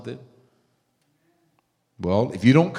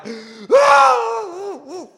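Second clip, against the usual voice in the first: loud high laugh; 5 dB louder than usual. Real, not acted. Congregation reacts to bride's answer.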